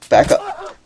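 high squeaky laugh through the words; laughing squeak. Real, not acted. Gamer laughing at his own crash, high squeal. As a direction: crack up as the car rolls back. Panicked game play, laughing.

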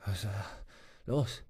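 faint, fading murmur from a dying man; weak murmur. A narrator's reading. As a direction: barely get the words out, weak and soft. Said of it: "murmelte Swanson schwach".

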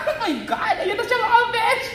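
high, laughing squeal; thrilled. Real, not acted. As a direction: squeal the good news to viewers. Phone screen finally appears. (tuwang-tuwang tili na may tawa)

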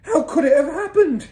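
very high, loud bewildered yell; father's yell. A narrator's reading. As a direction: yell it in disbelief. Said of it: "he yelled".

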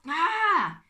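loud, high "ah" of pain; sharp cry as if bitten; yelp of pain. A narrator's reading. Trapped Uncle Max gets bitten, pained yelp. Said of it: "He sucked in his breath".

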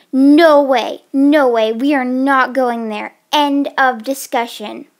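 loud, emphatic veto; forceful refusal. Acted. Friend refusing to go to that house, loud and adamant. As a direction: shut the idea down hard and loud. Flatly refusing the last option.

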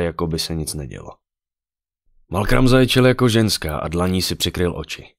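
plain reading voice

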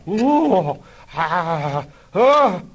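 high, loud mock moans of ecstasy; hammed-up moans. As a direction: overdo the climax cries. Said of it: "ejaculated Biggles and Algy together".

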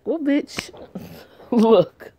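high, laughing sassy jab; sassy laughing aside. Real, not acted. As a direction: laugh and scold her.

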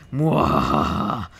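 slow low demonic cackle; evil cackle. Pretend. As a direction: cackle slowly and deep like a demon. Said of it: "sounding like a fucking demon".

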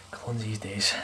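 whispered grumble under breath; soft whispered complaint; whispered aside. Real, not acted. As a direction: mutter it to yourself in a whisper. Muttered aside to himself.

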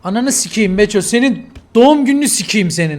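loud, angry cursing; angry outburst. Real, not acted. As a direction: curse your friend out loudly. Angry outburst at friend about birthday.

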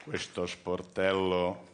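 slow metallic machine voice; lightly voiced cash machine robot. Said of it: "una voce metallica mi ha detto".

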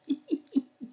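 high cackling laugh like a witch; witch cackle. Real, not acted. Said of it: "Sound like a witch".

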